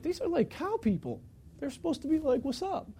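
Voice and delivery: quiet aside. Retold or imitated. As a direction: say it under your breath, puzzled. Inner thought about cow people.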